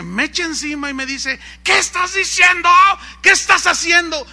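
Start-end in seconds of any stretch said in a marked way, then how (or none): 1.6-4.3 high, loud outraged shout; angry father. Retold or imitated. Angry father turning on his praying son, furious acted shout. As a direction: demand answers at full volume, high-pitched. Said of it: "con su cara de enojo".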